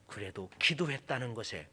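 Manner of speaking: ordinary talk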